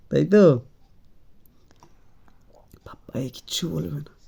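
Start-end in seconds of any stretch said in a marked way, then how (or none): 2.8-4.1 put-on conspiratorial whisper into the baby's ear; mock secret whisper. Father mimicking the mother's ear-whisper, playful whisper. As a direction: hush it like a shared secret. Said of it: "কানে কানে".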